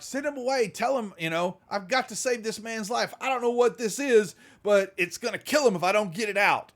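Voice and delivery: frantic doctor. Acted.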